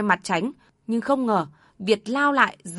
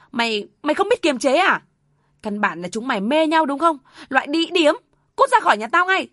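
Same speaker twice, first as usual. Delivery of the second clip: higher and louder, spitting contempt; furious insult; 6 semitones higher, 4 dB louder than usual. Acted. Mother cursing her daughter-in-law, enraged shout. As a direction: throw her out of the house in a rage.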